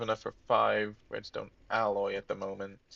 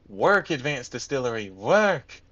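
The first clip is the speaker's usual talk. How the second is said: exasperated command; 3 semitones higher, 6 dB louder than usual. Real, not acted. Player orders the full distillery to work, exasperated command. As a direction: urge a stubborn machine on, punching the last word. Pleading with a jammed machine.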